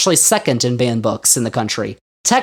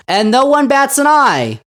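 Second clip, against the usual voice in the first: ordinary talk, nothing marked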